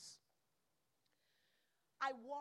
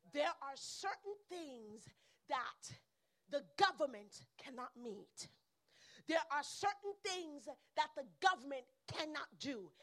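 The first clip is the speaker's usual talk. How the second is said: building, louder preacher cadence; preaching; 3 semitones higher, 7 dB louder than usual. Acted. Preacher stirring the congregation about the church's calling. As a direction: drive the point home to the congregation.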